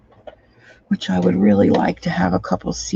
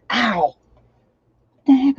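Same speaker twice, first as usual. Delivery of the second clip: stubbed toe; 6 semitones higher than usual. Real, not acted. Just stubbed her toe.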